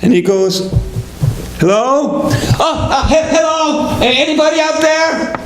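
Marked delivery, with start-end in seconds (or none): 1.6-2.2 questioning call. Acted. Passerby answers the yells. 2.6-5.4 high, flustered shout back; acted eager calling. Family hears a second passerby, eager high calling.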